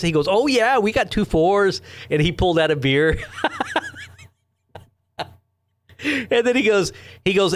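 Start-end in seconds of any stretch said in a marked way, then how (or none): 0.3-1.8 high, cheery mock Canadian accent; a playful Canadian "oh yeah"; imitating a Canadian. Canadian guy offering the beer, light mock Canadian accent. 3.2-4.0 high, breathy burst of laughter. Real, not acted. Laughing at the Canadian beer joke, short burst of laughter.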